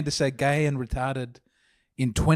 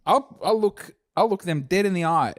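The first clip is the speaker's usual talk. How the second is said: cocky pitch guy; 6 semitones higher than usual. Pretend. Acting a cocky sketch pitch.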